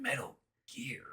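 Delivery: hushed, whispered game-character line; imitated whisper. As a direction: whisper it like a secret discovery. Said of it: "And then he'll be like".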